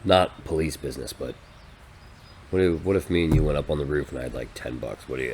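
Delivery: very low, hushed imitation of a cop; furtive proposition. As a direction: drop it low and soft, off the record. Acted cop's furtive proposition.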